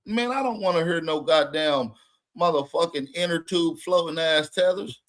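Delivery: ordinary talk, nothing marked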